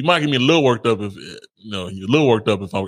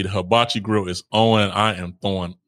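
ordinary talk

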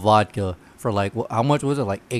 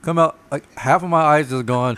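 normal talking voice

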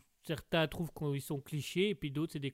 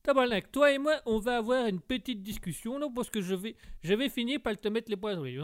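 put-on higher, loud, angry Québécois accent; 7 semitones higher, 5 dB louder than usual. Québécois guest threatens the host, angry high Québécois accent. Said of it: "vous êtes un Québécois bûcheron".

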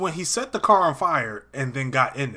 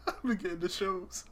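laughing; 5 semitones higher, 11 dB quieter than usual. Real, not acted. Cracking up after mom story.

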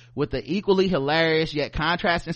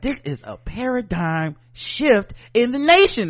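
high, mock-grand presentation voice; hammed-up corporate presenter; 7 semitones higher, 3 dB louder than usual. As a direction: announce it like a big-word business pitch. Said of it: "when I worked in corporate America".

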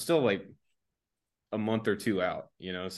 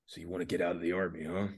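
low, quick and much softer menace; intimidating sergeant major; 3 semitones lower, 8 dB quieter, faster than usual. Retold or imitated. Sergeant major quizzing the private, lightly imitated low and quick menace. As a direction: lean in like a drill sergeant testing him. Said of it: "the whole intimidation thing".

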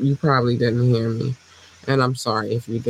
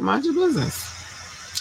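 high falsetto tagline; 13 semitones higher, 5 dB quieter, slower than usual. Pretend. Signature sign-off.

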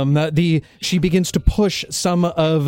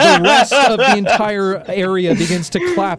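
raised, higher, louder storytelling; excited narration; 6 semitones higher, 3 dB louder than usual. A narrator's reading. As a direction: build the crowd's applause. Excited applause scene narration.

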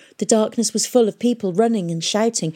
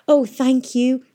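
bright, higher, louder "thank you"; happy thanks; 6 semitones higher, 4 dB louder, slower than usual. Acted. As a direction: thank him with delight. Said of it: "she said happily".

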